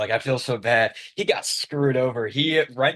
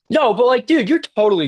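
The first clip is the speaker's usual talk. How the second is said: much higher, louder burst of disbelief; excited protest; 11 semitones higher, 6 dB louder than usual. Real, not acted. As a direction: jump in loudly to reject the doubt. Protesting friend's modest doubt.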